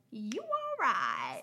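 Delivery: very slow Southern drawl; Southern greeting. Retold or imitated. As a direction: toss it out with a wink. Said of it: "we do, like, a wink and a point".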